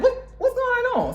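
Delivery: high, startled squeal; startled Cyrax. Retold or imitated. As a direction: caught off guard. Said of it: "Cyrax was like".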